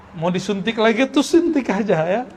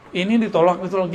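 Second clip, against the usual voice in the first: normal talking voice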